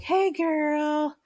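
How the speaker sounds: high-pitched, drawn-out imitation of husband; put-on falsetto-like greeting. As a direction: sing it out way up high, mimicking him. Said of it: "in a very high voice".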